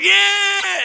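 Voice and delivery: high, loud cheering crowd. Retold or imitated. Crowd answers the tightrope walker with a loud high cheer. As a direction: yell it like an excited crowd. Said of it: "And they went".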